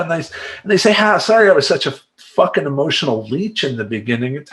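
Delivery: usual speaking voice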